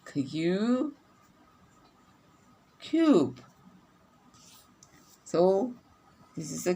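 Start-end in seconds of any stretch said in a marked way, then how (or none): 2.8-3.4 cube said high with a long U; model word. Shown as a demonstration. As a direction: stretch the long U in cube for the class. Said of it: "So what sound is this?".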